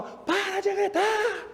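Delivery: a parent shouting stop shouting, slowly; parent yelling. Retold or imitated. Preacher mimics a parent yelling at the child, high and slow. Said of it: "mas fala".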